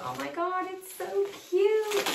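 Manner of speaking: squealing high and slow over how cute it is; gushing over cute plant. Real, not acted. Friend unboxing a plant on camera, squealing at its cuteness. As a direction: melt over the little plant, drawn-out and squeaky.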